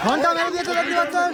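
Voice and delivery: high, loud start call; excited shout. Acted. Bell rung, race begins. (উত্তেজিত চিৎকার)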